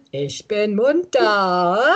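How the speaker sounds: slow, giggling through the words; laughing yoga chant. Shown as a demonstration. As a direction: say it while laughing. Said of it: "es geht los mit Ich bin munter".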